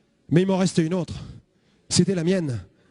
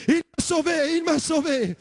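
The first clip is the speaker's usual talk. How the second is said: higher, joyful exclaiming; jubilant; 7 semitones higher, slower than usual. Retold or imitated. Young convert leaping over bins, jubilant.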